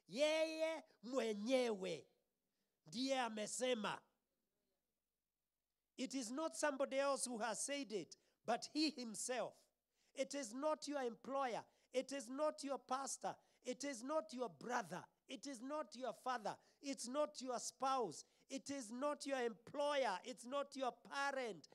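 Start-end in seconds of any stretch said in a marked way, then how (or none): 0.1-4.0 "Yeye mwenyewe ndiye amesema" said very slowly and louder; Swahili rendering. Shown as a demonstration. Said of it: "actually it is an emphatic he". 10.1-21.7 preaching at full force. Acted. Preaching litany of negations.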